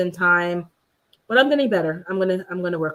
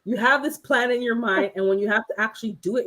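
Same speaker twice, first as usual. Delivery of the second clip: usual speaking voice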